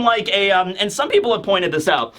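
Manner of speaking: ordinary talk, nothing marked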